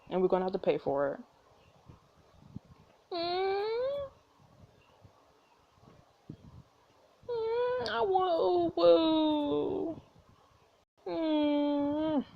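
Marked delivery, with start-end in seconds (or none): 3.1-12.2 put-on high, slow, drawn-out kid whine; whining child. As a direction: whimper for it like a small child.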